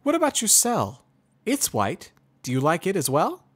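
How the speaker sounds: high, loud mare explaining kindly; Twilight explaining. Acted. Twilight changes topic.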